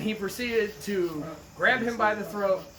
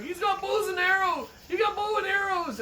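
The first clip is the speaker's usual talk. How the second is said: panicked caller; 12 semitones higher than usual. Retold or imitated. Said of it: "he's like".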